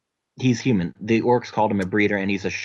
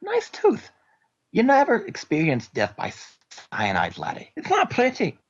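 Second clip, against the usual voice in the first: put-on gruff Scottish accent, pitched high and rolling; a dwarf sneering in thick Scottish; Scottish brogue; 12 semitones higher than usual. Scottish mercenary taunting the captured spy. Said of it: "I look at it and I'm like".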